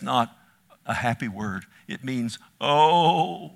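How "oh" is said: wounded groan. Retold or imitated. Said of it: "he's wounded in his heart".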